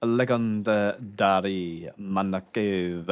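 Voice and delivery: drawn out, savoring every syllable; slow grand announcement. Real, not acted. Gamer reads out a funny town name, slow and grand. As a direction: announce it like a grand title, very slowly.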